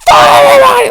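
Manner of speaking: high, loud yell; mock shout. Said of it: "being like".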